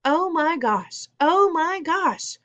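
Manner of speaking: loud, high, stretched-out squeals; screaming with excitement. A narrator's reading. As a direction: squeal it slowly, overjoyed. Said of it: "Mom was screaming with excitement".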